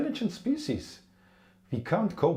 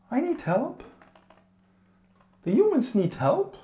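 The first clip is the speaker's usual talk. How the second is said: pleading; 10 semitones higher than usual. Acted. Desperate plea for help.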